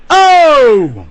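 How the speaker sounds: a sudden high, loud oh; playful shocked exclamation; mock startled oh. Real, not acted. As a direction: yelp as if caught out. Catches himself saying something taboo.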